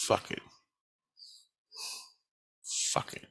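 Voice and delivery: low-volume, resigned aside; barely voiced, trailing into silence; resigned muttering. Real, not acted. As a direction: mutter it softly, giving up. Despairing aside, giving up.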